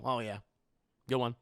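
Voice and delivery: usual speaking voice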